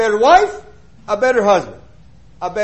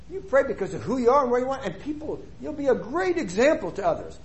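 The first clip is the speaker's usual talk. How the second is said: ordinary talk, nothing marked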